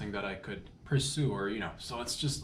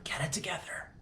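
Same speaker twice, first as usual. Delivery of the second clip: high, whispered, hammed-up teary plea of "Get it together"; mock tearful whisper; 8 semitones higher, 3 dB quieter than usual. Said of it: "I'm so emotional".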